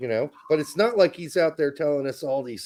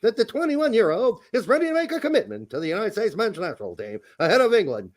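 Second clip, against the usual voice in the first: 1950s news voice; 6 semitones higher than usual. Shown as a demonstration. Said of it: "That's my nineteen fifties news voice".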